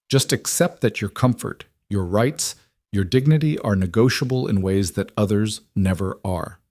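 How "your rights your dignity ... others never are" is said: ordinary delivery, nothing marked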